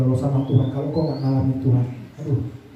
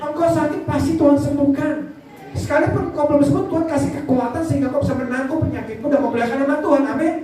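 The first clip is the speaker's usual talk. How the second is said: high, insistent sermon proclamation; fervent preaching; 11 semitones higher than usual. Real, not acted. Preacher promising healing to the sick, fervent preaching. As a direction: proclaim it with raised pitch, driving for an amen.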